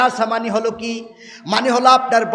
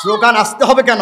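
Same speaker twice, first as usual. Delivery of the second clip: usual speaking voice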